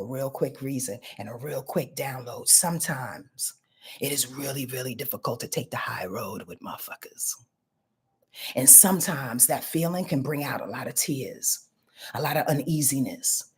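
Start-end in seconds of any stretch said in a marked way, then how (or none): 2.5-13.4 hushed, breathy confiding whisper, low and soft. Acted. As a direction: let it out like a secret. Whispered astrology aside to viewers.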